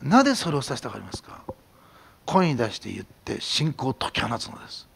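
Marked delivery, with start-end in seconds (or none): none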